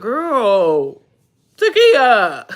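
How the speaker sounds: loud, high cry of delight; real happy outburst; excited shout. Real, not acted. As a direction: call out the name, thrilled, breaking into a laugh. Reacts to viewer's generous comment.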